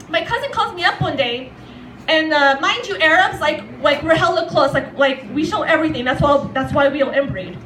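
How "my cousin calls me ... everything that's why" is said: normal talking voice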